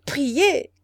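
sharp, loud cry of despair. A narrator's reading. A widow cries out in despair. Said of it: "cria-t-elle".

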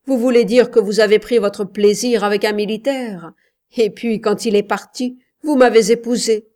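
husband's rage rising to a loud finish. A narrator's reading. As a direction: fling the charge at her. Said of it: "blanc de fureur".

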